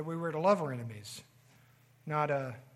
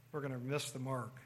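soft repeat; 4 semitones lower, 8 dB quieter than usual. Shown as a demonstration. Preacher echoing his warning to the congregation, quietly. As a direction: let the line sink in quietly. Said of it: "Or again, we're gonna miss the mark".

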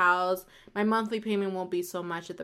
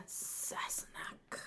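slow whispered Scottish Sassenachs with a sharp snap on the end; Jamie's Scottish whisper; 17 dB quieter, much slower than usual. Retold or imitated. Imitating Jamie Fraser for Outlander fans, slow Scottish whisper. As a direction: breathe it like Jamie Fraser, snapping the final k. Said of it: "say it like Jamie does".

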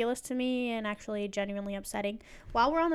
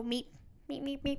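soft squeaky meeping like the Muppet lab assistant; quiet mock meep meep; Muppet Beaker voice; 3 semitones higher, 11 dB quieter than usual. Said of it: "You sound like the guy from the Muppets".

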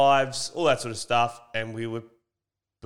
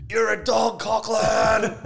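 heckling yell; 8 semitones higher, 3 dB louder, slower than usual. Retold or imitated. Heckling again at the game.

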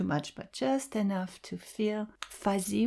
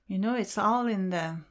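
ordinary talk

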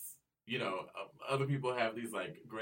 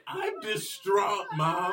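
loud, high, slow mock wail; mock distraught; 12 semitones higher, 10 dB louder, much slower than usual. Son to mom, loud mock distressed wail. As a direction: ham up the distress.

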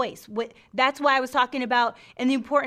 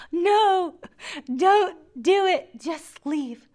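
high, loud mock-distraught begging; theatrical protest, dropping off at the end; overacted desperate plea; 6 semitones higher, 6 dB louder, slower than usual. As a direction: beg like a melodrama heroine. Acting mother refusing to give son.

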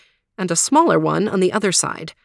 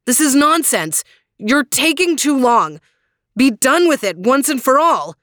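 much higher, louder impatience; impatient anger; 5 semitones higher, 4 dB louder than usual. Acted. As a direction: demand it be over. Gawain impatient.

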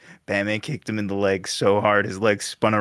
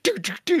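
drumming sound; faster than usual. Retold or imitated. Imitating drumming Batman.

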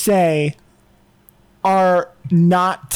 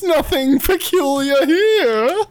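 mock innocent; 6 semitones higher, slower than usual. Innocent cover-up after fog joke.

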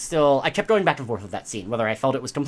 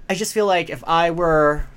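ordinary talk

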